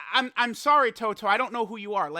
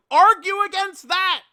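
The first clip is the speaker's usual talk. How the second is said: loud, high-pitched challenge; shouted challenge; 11 semitones higher, 11 dB louder than usual. Real, not acted. Streamer challenging his critics, shouted. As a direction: throw it out loudly as a dare.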